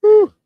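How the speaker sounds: loud, high exhaled "whew". Real, not acted. Co-host marvelling at the champion's wins, high whew. Marvelling at how hard winning is.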